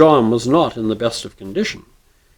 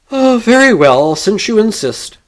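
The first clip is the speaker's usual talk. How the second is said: weary sigh; 9 semitones higher, 5 dB louder than usual. A narrator's reading. Stranger sighs, accepts challenge.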